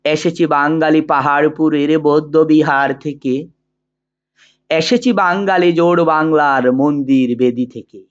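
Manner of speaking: measured, sustained poetry recital with drawn-out phrase endings; poem recitation. Acted. Teacher reciting the poem Amar Porichoy for students, in measured declamation. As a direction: declaim the verse slowly and evenly, pausing between couplets.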